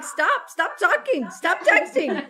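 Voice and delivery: high, slow, emphatic mock order; urgent warning. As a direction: imitate frantic pleas to stop. Retold warning on group text.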